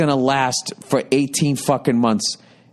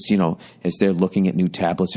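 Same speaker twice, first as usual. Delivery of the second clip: ordinary talk, nothing marked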